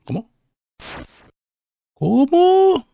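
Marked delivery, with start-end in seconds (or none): 1.9-2.9 high, loud disbelief; shocked shout. Real, not acted. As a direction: yell it in total shock. Reacción al giro del jefe. (grito de sorpresa)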